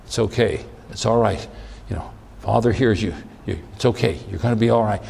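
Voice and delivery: soft, low reassurance; calm him like a frightened friend; the angel from heaven softly reassuring Jesus; comforting angel. Acted.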